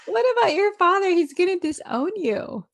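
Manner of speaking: high, loud hammed-up questioning; mock pleading; mock concerned friend. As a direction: tease her in mock worry. Playful mock dialogue.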